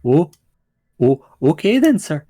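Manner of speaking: cowed gnome with a Scottish lilt; Scottish accent. Acted. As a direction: stammer in an accidental Scottish accent. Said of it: "I'm doing a Scottish accent".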